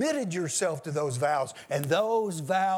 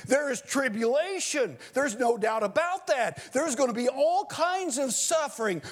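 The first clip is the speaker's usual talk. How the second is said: high, ringing sermon build; fervent pulpit preaching; preaching at full force; 6 semitones higher than usual. Acted. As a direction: hammer each line home to the congregation.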